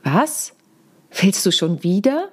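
WAS set apart as its own question, then rising wieder; "Was?" alone, pause, rising end on "wieder"; stress on Was. Shown as a demonstration. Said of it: "die Betonung einzelner Worte verändern".

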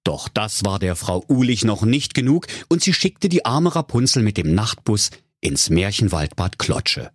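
usual speaking voice

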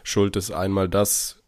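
ordinary talk

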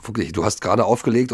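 normal talking voice

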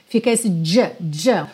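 ordinary delivery

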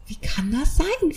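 high, slow, stunned question; disbelieving cry. Retold or imitated. Mother told she is late, stunned.

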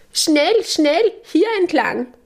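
very high urgent mouse call; Lilli. A narrator's reading. Church mouse leading the friends inside, high urgent call. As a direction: hurry them along in a high little voice. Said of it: "Lilli ruft".

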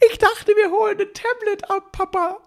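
put-on high little boy's voice, surprised; a kid's high voice asking his papa; child voice. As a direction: play the small son, high and puzzled. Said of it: "Und er war so".

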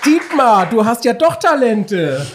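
loud, high laughing tease. Real, not acted. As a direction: tease him through laughter. Teasing the singer after his singing.

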